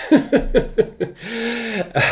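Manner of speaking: a bright, high burst of laughter. Real, not acted. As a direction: laugh it off lightly. Laughs about shampoo bottles.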